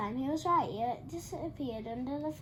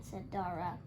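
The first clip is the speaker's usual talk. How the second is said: ordinary delivery, nothing marked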